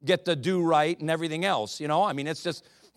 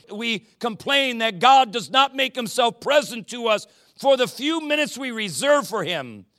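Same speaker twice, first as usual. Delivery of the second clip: normal talking voice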